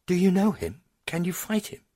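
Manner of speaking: put-on high, loud young girl's voice; anxious girl. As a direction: plead urgently with mother. Frightened girl asks her mother.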